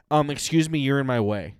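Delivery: imitated indignant shopper; mock confrontation. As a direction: demand the aisle, loud and blunt. Imitates Barbara confronting someone in store.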